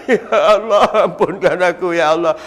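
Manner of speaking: slow, laughing mock weep to God; fake crying. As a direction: fake a tearful plea while half laughing. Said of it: "Buat-buat menangis". (tangisan pura-pura yang perlahan)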